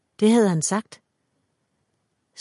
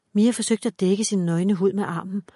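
plain reading voice

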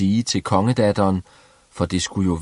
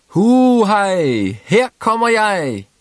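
shouting gleefully; 12 semitones higher, 5 dB louder than usual. A narrator's reading. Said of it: "råbte Klods Hans".

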